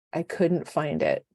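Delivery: ordinary talk, nothing marked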